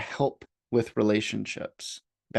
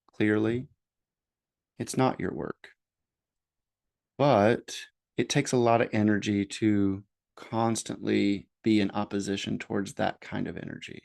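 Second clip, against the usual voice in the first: normal talking voice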